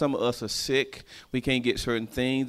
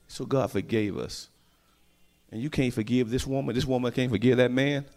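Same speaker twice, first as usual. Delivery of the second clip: normal talking voice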